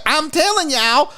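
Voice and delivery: high, exaggerated Southern twang; South Carolina drawl. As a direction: put on a mock Southern accent. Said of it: "sound like he was from South Carolina".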